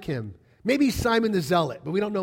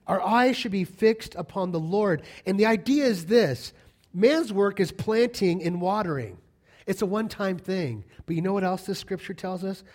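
ordinary talk, nothing marked

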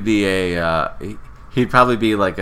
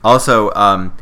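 normal talking voice

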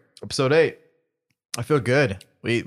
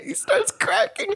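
cracking up. Real, not acted. Friend laughs in class.

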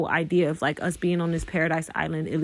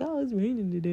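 complaining; 4 semitones higher, 6 dB quieter, faster than usual. Retold or imitated. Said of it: "We be like".

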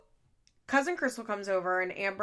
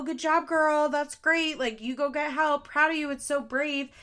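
high, louder mock cheerleading; mocking praise; 5 semitones higher, 5 dB louder than usual. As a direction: parody gushing praise, sarcastic. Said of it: "it's like, 'Oh".

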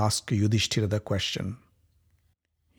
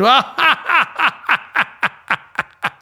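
high, loud cruel cackle; evil laugh; 13 semitones higher, 6 dB louder than usual. Acted. Dussasana laughing at the captive queen, a loud cruel cackle. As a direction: laugh in her face. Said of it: "Dussasana laughed and said".

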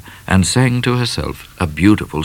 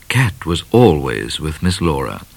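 ordinary delivery, nothing marked

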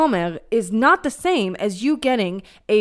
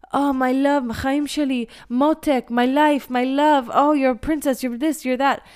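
higher, louder imitation of a guy sweet-talking; mock sweet talk; 6 semitones higher, 5 dB louder than usual. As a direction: ham up the Israeli charmer's cooing. Imitating Israeli guys' pet names.

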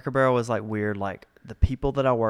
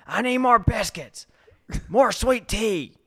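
high mock yelling customer, slow on sweet tea; hammy impression of a demanding regular; 12 semitones higher than usual. Server retelling a customer yelling for refills, mock shout. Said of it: "she would yell at you from there".

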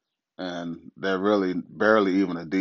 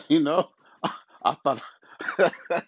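laughing; 7 semitones higher than usual. Real, not acted. Laughing at his own joke.

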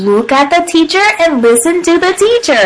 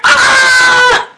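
long high-pitched mock scream; 11 semitones higher than usual. A narrator's reading. Froggy caught in his underwear, high scream. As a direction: scream in cartoon embarrassment.

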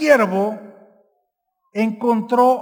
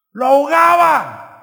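loud emphasis; 4 semitones higher, 5 dB louder than usual. Real, not acted.

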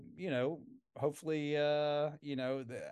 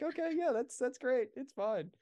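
mock agreeable; 9 semitones higher than usual. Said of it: "be like".

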